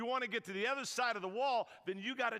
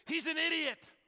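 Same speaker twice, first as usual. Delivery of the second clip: indignant lady; 5 semitones higher than usual. Retold or imitated. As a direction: scoff it out like an outraged churchgoer. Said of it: "and I quote".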